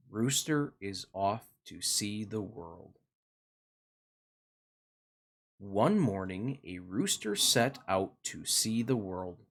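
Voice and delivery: put-on gentle storybook narration for kids; reading to children. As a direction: read it like a bedtime picture book. Reads picture book to children.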